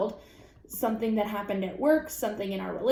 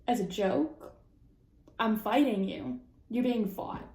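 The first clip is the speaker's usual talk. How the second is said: ordinary delivery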